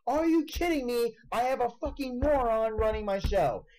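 mock-yell the rant at the co-host; half-acted angry on-air rant; retold yelling. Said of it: "So I'm yelling at".

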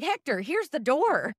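high, pitch climbing mock exasperation; mock exasperated shout. To the lingering bellboy, mock exasperated and rising high. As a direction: shoo him out, pitch rising each time. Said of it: "I'm like".

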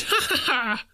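high, joyous hearty laugh; splendid laugh. A narrator's reading. Scrooge, out of practice for years, laughing a splendid laugh. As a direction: laugh like you've forgotten how. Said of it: "it was a splendid laugh".

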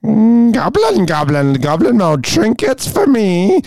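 drawn-out gleeful goblin sign-off. Pretend. Signing off in goblin mode.

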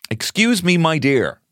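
high, theatrical courtly address; hammed-up gallant suitor. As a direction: play an old-fashioned suitor, pitched high. Hammed-up old-fashioned dance request.

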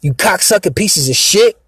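snapping in anger. Retold or imitated. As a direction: retell the blow-up, voice jumping up. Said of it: "I just snapped the fuck out".

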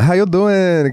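high-pitched put-on Puerto Rican accent; hammed-up Puerto Rican accent; Puerto Rican-style accent. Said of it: "That's Puerto Rican".

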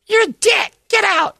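high squeaky falsetto, loud; hammed-up furious girly squeal; mock angry girl. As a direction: snap at him in a put-on girl voice. Angry girl in pool story.

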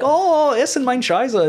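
high, slow mock German chant. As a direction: slowly belt out the South Park German line. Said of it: "that whole, like, South Park episode".

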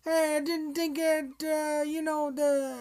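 high, slow, hammed-up Chicago accent; cheesy Chicago accent. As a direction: drawl a cheesy Chicago movie critic. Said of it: "the cheesed up Chicago accent".

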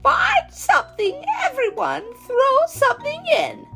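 very high, gleeful witch voice; grinning witch. Acted. Witch rallying friends round the cauldron, high and gleeful. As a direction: call it out high through a grin. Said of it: "said with a grin".

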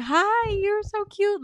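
high, slow and sugary; slow, high, friendly hello; sweet greeting. Shown as a demonstration. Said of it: "You wanna be sweet and say".

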